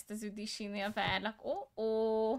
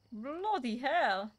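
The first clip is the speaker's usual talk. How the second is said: British chef shout; 4 dB louder, much slower than usual. Retold or imitated. Chef impression again.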